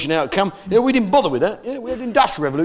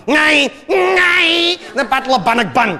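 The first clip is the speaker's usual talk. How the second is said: bellowing Scottish patriot; 11 semitones higher, 4 dB louder than usual. Acted. Comedian mocks Scots recalling Bannockburn, hammed-up battle roar. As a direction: roar it like a rousing chant of an old battle. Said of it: "you like to say, don't you, Paul?".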